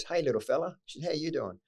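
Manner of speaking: a hint of a warm older woman; lightly voiced kind lady. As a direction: greet him like a kindly neighbour. Voicing kind lady over fence.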